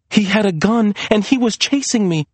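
high, frightened protest; urgent insistence. A narrator's reading. Maria insisting to doubting Serena, urgent. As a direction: press to be believed.

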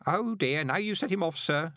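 high-pitched gossipy underling; lively, high character voice; chatty old postman Grote. Acted. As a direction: relay grim gossip with relish, pitched well up.

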